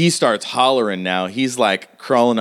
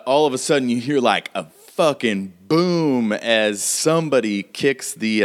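usual speaking voice

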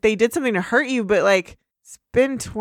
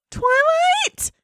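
very high, drawn-out squeal for help; alarmed Fluttershy. Acted. Fluttershy calling her friend amid the fighting, shrieking alarm. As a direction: squeal the name in mock panic.

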